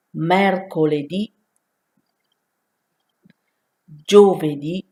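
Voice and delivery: repeat after me. Shown as a demonstration. Italian teacher drilling beginners on the days, loud and clear. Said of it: "So let's repeat after me".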